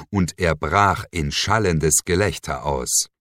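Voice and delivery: normal talking voice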